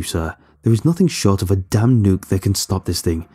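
plain reading voice

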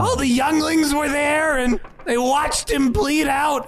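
high, excited little kobold; a small reptile creature chattering. Acted. Trash the kobold speaks.

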